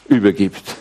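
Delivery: ordinary talk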